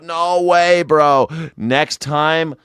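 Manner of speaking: ordinary talk, nothing marked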